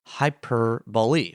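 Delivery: "hyperbole" slowly, as hyper, then BO-lee; slow, deliberate "hyperbole" with a clear final ee; broken into parts. Shown as a demonstration. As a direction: sound out "hyperbole" in pieces, hy-per-bo-lee. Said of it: "Instead, it's two symbols".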